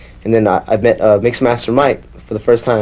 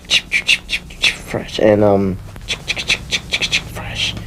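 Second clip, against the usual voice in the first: softer, whispered chip chip fresh; whispered scratch sounds; 2 semitones lower, 5 dB quieter than usual. Shown as a demonstration. Demonstrating Rocket scratches in a soft whisper. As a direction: breathe the scratch rhythm. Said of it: "all the Rocket scratches".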